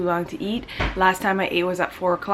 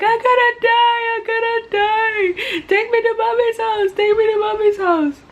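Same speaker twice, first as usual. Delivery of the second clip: put-on high, loud, childlike crying plea; mock crying; 14 semitones higher, 5 dB louder than usual. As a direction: replay the panic as a hammed-up sobbing wail. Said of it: "So I'm there crying".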